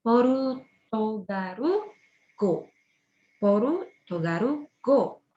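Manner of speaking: Porutogarugo said po-ru-to-ga-ru-go, very slowly; slow Japanese reading. Shown as a demonstration. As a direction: draw out Porutogarugo syllable by syllable. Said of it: "Jadinya nama bahasanya".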